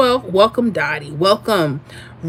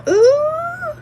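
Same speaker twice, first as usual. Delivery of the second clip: high ooh. Real, not acted. Reacting to chat comment.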